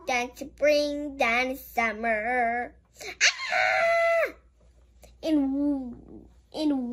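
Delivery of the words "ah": high squeal of delight; delighted shriek; excited cry. Real, not acted. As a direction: squeal at the picture. Excited at picture.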